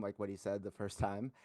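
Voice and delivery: ordinary talk, nothing marked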